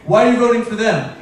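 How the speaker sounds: usual speaking voice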